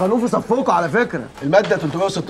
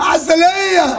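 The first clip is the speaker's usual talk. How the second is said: drawn-out weak groan of a hurt man; pained moan; 8 semitones higher, much slower than usual. Acted. Hurt man moaning his name out slowly. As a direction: beaten brother moaning his name to them.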